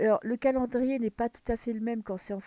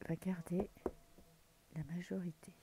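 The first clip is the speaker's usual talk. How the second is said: chuchoté; 2 semitones lower, 9 dB quieter than usual. Real, not acted. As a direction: question chuchotée, presque sans voix. Question murmurée aux cartes. (question chuchotée aux cartes)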